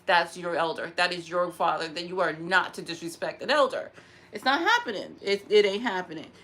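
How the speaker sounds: normal talking voice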